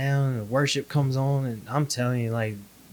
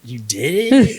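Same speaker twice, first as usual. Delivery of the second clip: higher, louder incredulous question; retold astonishment; 4 semitones higher, 9 dB louder, slower than usual. Husband hears wife went through deliverance, astonished. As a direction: react in mock disbelief at the news. Retold shock at wife's news.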